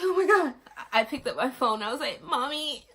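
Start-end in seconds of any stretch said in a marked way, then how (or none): 0.0-0.5 high, loud burst of shock. Retold or imitated. Woman realizing her water just broke, shocked. 2.2-2.9 calling mom. Retold or imitated. Calling her mom for help.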